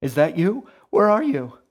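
loud, high, frantic mother; rising panic. A narrator's reading. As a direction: cry out in mounting alarm. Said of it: "the panic rose in her voice".

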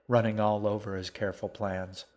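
ordinary delivery

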